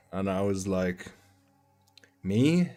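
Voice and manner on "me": mock surprise. Feigning innocence to soldiers.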